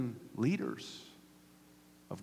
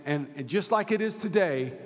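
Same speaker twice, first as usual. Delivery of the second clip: usual speaking voice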